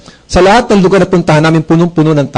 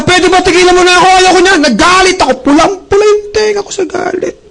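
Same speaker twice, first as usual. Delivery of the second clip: much higher mock angry shout; retelling his own angry outburst at the desk clerk; retold shout; 12 semitones higher than usual. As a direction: act out yelling at the clerk, half in jest. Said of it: "Sinigawan ko siya".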